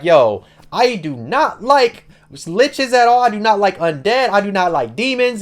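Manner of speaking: high, exasperated mock denial; indignant, voice pitched well up; emphatic protest. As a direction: push each denial out emphatically. Duke protests to confused group.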